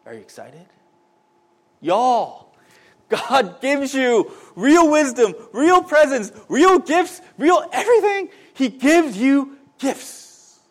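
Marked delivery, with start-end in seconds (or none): 1.8-10.6 slow, high, loud exhortation; building higher with each item, real zeal; fervent preaching. Real, not acted. Preacher telling the congregation what God gives, fervent preaching.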